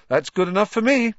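gleeful Ron accepting the owl; happy. A narrator's reading. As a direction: beam it out, thrilled. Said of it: "said Ron happily".

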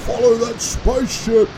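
high, urgent grandpa order; rousing call to pursuit; excited command. A narrator's reading. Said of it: "Grandpa Tub told Curtis".

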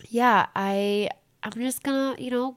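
normal talking voice

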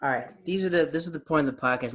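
ordinary talk, nothing marked

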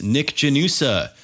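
ordinary delivery

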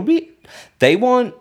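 sudden high, loud burst; exasperated outburst. Real, not acted. Vlogger ranting about nosy friends, a sudden high loud burst. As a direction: throw the word up in frustration.

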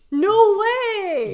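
very high, loud mock exclamation; Chris Ramsey. Co-host acting out contestant's task reply, loud high imitation. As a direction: shout it high, mimicking his reply. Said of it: "Chris Ramsey has to go".

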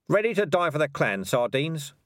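a rousing war cry to Sardines; rallying shout. Acted. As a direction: call it out to the troops. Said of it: "Dark Tan shouted".

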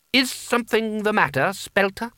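high, pointed questioning; lofty, probing enquiry; imperious boy wizard. A narrator's reading. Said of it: "said Coin".

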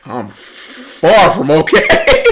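slow, drawn-out with a laugh; laugh-moan it out slowly; mock despair.

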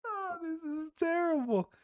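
high squeal of amused horror; laughing in disbelief. Real, not acted. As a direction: laugh through it, voice cracking up. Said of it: "reacting to bad anthem".

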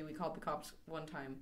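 soft mock line. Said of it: "You were gonna say".

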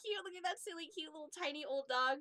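put-on high, doting baby talk for a puppy; cooing at a cute dog. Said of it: "we're like".

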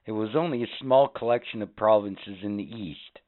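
lively history-show narrator voice; mock history-program read; documentary narration. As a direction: present it like an educational TV segment. Reads a history segment.

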